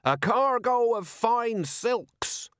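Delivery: high, slow declaiming voice; orator's cry. A narrator's reading. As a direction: proclaim it to the whole square. Orator answers crowd, still declaiming.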